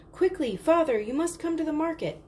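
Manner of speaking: high, breathless urgent call; panting. Acted. Chandra runs home to her father, panting and urgent. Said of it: "Chandra panted".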